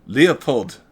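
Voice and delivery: loud barked name; sharp shout. Acted. The doctor snaps at Leopold, loud shout. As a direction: call him out sharply.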